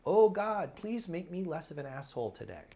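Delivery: loud, high mock plea to God; mock pleading prayer. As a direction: beg God, hammed up. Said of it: "petitionary prayer".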